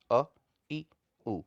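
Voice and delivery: brief, separate "अ", "इ", "उ", one beat apiece; short vowels. Shown as a demonstration. Teacher repeating one-beat vowel examples, short vowels. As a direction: give "अ, इ, उ" as quick single sounds. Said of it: "इस तरीके से".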